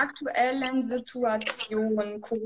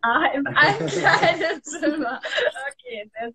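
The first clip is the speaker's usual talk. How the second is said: laughing; 5 semitones higher, 6 dB louder than usual. Real, not acted. Host laughs at dressing-room location.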